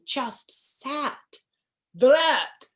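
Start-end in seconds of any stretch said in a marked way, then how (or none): 1.9-2.7 louder annoyed drat; frustrated vampire snap. Acted. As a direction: curse the stuck pumpkin. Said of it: "said the vampire".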